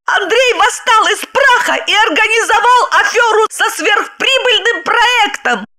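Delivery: high triumphant voice with laughter; gloating wife. Acted. Wife gloats that Andrei took revenge, high laughing voice. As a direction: rub his nose in it.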